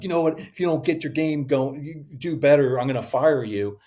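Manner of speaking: mock stern warning; lightly hammed-up harsh manager; threatening boss. Boss threatening to fire a worker, mock stern.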